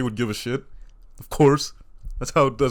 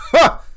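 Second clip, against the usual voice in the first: loud barking laugh; 8 dB louder than usual. Real, not acted. Laughing at himself.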